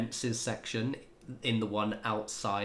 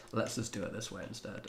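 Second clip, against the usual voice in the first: normal talking voice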